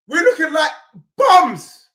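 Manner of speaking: high, loud cry of disgust; shouting. Real, not acted. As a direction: wail the word in despair. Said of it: "I keep shouting".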